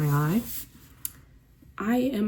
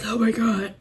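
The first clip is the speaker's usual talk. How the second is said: breathless oh my God, higher; mock panting; 4 semitones higher, 4 dB louder than usual. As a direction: puff it out like after a run. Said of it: "I'm suddenly like".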